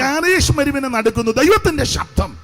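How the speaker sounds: loud, emphatic scripture proclamation; full-force preaching. Real, not acted. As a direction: drive each phrase out to the congregation. (ഉച്ചത്തിൽ ഊന്നിയുള്ള പ്രസംഗം)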